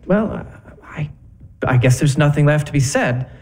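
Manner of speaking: low, halting acceptance; resigned. Acted. Husband accepting wife's divorce demand, deflated. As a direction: pause often, voice sinking.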